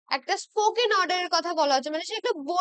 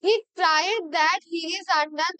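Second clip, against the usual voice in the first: slow dictation; much slower than usual. Shown as a demonstration. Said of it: "আর".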